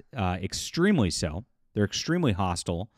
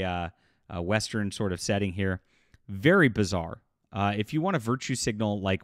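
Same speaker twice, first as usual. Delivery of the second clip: ordinary delivery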